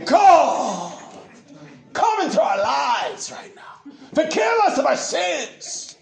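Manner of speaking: high, slow, drawn-out mock prayer cry; dramatic false teacher. Preacher mimicking false teachers' sinner's prayer, overdramatic. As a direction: wail the prayer out in showy parody. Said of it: "They're all dramatic and all this nonsense".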